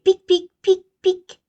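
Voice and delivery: very high, loud squeaky bee voices; stinging bees. Acted. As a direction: bees jabbing the bear.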